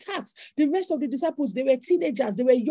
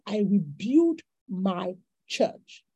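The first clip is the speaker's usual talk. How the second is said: lightly voiced Jesus, drawn out and grave; solemn Jesus; 7 semitones lower, much slower than usual. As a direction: lower and slow, a touch solemn, voicing Jesus. Jesus quoted, solemn and slow.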